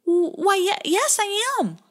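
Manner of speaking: high, stammering and nervous; scared stammer. Acted. Pie Jam answers the otter, high nervous stammer. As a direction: stumble over the words, frightened.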